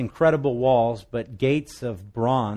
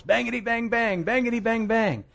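mock gunfire; 9 semitones higher than usual. Soldier firing broom in battle.

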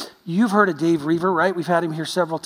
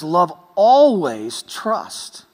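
drawn out slowly, each word weighed; slow emphatic quote; 4 dB louder, much slower than usual. Real, not acted. Preacher quoting scripture, slowly and deliberately. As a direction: lay out the verse very slowly. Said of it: "When the Bible says".

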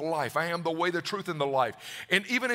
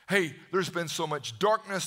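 ordinary delivery

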